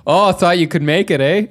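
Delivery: a light mock Canadian accent, taunting a friend with a broken leg; chirpy Canadian teasing, eh tacked on. To a hurt friend, a jokey Canadian accent. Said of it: "a more Canadian accent".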